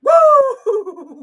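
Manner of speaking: high whooping woo; mock woo. Repeats whoop.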